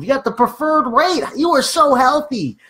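high, gushing praise; hammed-up congratulation to get a laugh; playful hype. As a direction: build to a slow, drawn-out compliment. Hyping client to make them laugh.

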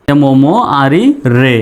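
usual speaking voice

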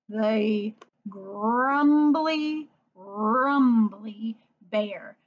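very slow, stretched grumbly rumbly; slow storybook drawl; drawn-out name. Shown as a demonstration. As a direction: stretch each word like a lazy bear. Teacher drawing out the bear name.

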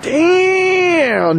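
high, long, stretched-out exclamation; drawn-out amazed damn. Real, not acted. Onlooker reacting to a race car on a trailer, amazed.

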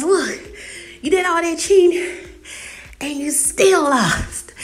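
high, loud playful gloat; gloating taunt. Retold or imitated. Brother catching up after being cheated, gloating taunt. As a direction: rub it in, drawing out the ending.

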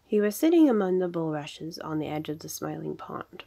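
ordinary delivery

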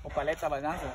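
chewing while talking; mouth full. Real, not acted. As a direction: fruit at lips. Seen 0: Hand at mouth with fruit, bowl of nance held, throughout.

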